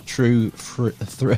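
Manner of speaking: stumbling over "true fr-, thr-", breaking off mid-word; tongue-tied stumble. Real, not acted. Host fumbling the guest's website name, tongue-tied. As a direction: trip on the words and give up. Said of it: "I can't even say it".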